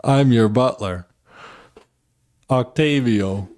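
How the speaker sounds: shaky-footed attempt at a French accent. Butler greets the billionaire on the yacht, attempted French accent. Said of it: "how do you do a French accent".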